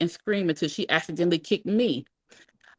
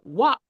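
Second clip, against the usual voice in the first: higher, sudden "whop"; belt smack imitation; 5 semitones higher, 4 dB louder than usual. A narrator's reading. Narrator imitating another belt blow, sharp smack.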